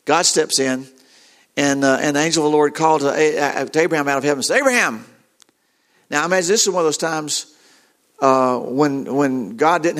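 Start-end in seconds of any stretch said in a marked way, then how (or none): 4.6-5.0 higher call with a falling end; lightly acted call from afar. Angel calls out from heaven.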